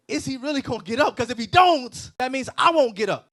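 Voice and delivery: loud, high-pitched alarmed outburst; mock panic. Voices apostles panicking as soldiers come.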